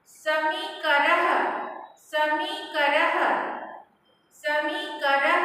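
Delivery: पत्रभारः drawn out slowly, final ह sounded; slow model word. Shown as a demonstration. Teacher drills पत्रभारः slowly for students to echo. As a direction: stretch पत्रभारः for repetition. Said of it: "you pronounce with me".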